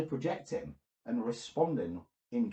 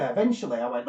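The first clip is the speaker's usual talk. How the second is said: ordinary delivery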